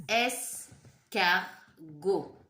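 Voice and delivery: escargot drawn out, every sound separate; very slow sounding out. Shown as a demonstration. Teacher to children listening for sounds, very slow. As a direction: stretch the word so children hear each sound. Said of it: "Est-ce que j'entends R?".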